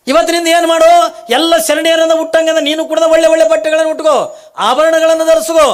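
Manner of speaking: high, loud booming divine command; voice from the sky. Acted. The heavenly voice ordering Kalavve to stop crying, booming. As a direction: proclaim it like a voice from heaven. Akashvani ಹಾಕದೆ.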